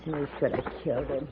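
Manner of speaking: bitter aside. Acted. Mother grumbles aside.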